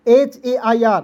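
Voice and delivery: letters एच ए आई आर spaced and clear; slow spelled letters. Shown as a demonstration. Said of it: "इसका उच्चारण एयर के रूप में".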